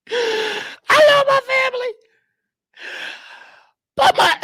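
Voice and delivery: high, fake sob on the words; mock crying. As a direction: wail it like a hammed-up cry. Same line redone mock crying.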